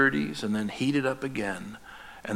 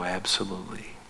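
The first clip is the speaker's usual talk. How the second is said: soft hushed whisper, lower; gentle whisper; 5 semitones lower, 9 dB quieter than usual. Acted. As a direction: answer in a tender whisper. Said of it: "And the Father will go".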